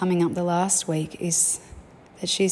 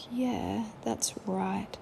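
soft, higher little voice; a tired child agreeing quietly; small quiet agreement; 4 semitones higher, 8 dB quieter, much slower than usual. Retold or imitated. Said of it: "She was like".

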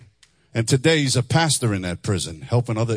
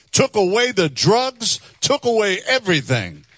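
fervent preaching; 8 semitones higher, 4 dB louder than usual. Real, not acted. Preaching climax.

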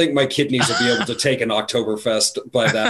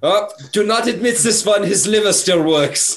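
mock German gatekeeper, pitched up; German accent; 7 semitones higher than usual. Barring a friend from Oktoberfest, mock German accent. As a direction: play an indignant German doorkeeper lightly.

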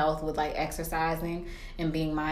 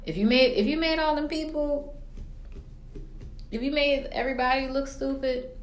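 indignant; 8 semitones higher than usual. Real, not acted. Indignant about lying.

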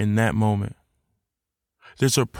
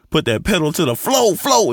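ordinary talk

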